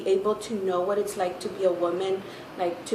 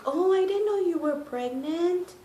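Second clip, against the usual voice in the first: high sing-song mock voice; gushing coworker, pitched way up; high mock surprise; imitating the guy; 9 semitones higher than usual. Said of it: "he was like".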